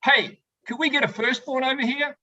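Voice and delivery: a secondborn begging for help, mock high call. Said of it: "The secondborns are like".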